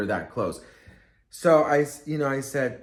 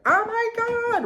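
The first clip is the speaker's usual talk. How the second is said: a sudden, much higher fan squeal; starstruck squeal; 4 dB louder, slower than usual. Retold or imitated. Spotting a celebrity in the store, a high starstruck squeal. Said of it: "not supposed to be like".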